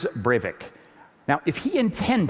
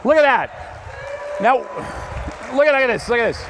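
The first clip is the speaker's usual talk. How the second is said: high, loud delighted call to look; thrilled presenter, much higher and louder; excited showing off; 7 semitones higher, 3 dB louder, faster than usual. Real, not acted. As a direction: proudly present the glowing wheels. Showing off lit wheels.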